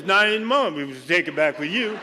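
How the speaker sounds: put-on lightly slurred drunk reply; second drunk babbling back. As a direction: answer in confident drunk nonsense.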